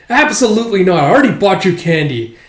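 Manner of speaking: exasperated parental no; stern father. Acted. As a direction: shut the kid down as an annoyed dad. Father refuses child.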